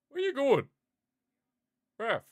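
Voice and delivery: high, loud call after the ref; high-pitched bewildered protest; calling out, baffled. Real, not acted. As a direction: shout after someone walking off. Calls out to the wandering ref.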